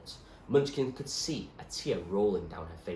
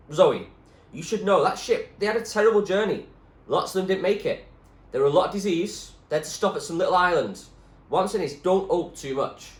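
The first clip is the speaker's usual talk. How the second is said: firm, louder warning; louder doctor voice; 11 semitones higher, 10 dB louder than usual. Acted. Doctor warning Zoe not to hope, louder firm voice. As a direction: warn her gently but firmly.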